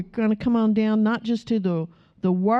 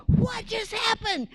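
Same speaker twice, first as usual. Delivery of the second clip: high, drawn-out stunned question; mock astonishment; 13 semitones higher, 3 dB quieter than usual. Hecklers suddenly fighting off bees, mock astonishment. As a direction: stretch it out high, imitating bewildered men.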